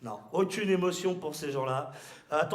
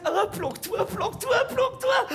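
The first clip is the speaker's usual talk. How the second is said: panicked hiding; 14 semitones higher, 3 dB louder than usual. Acted. Inspectors hiding near the machine.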